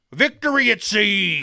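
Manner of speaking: loud, drawn-out pirate voice. Acted. Reading a beer name to co-host, pirate voice. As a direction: bellow it slowly like a pirate. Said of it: "gotta say it like a pirate".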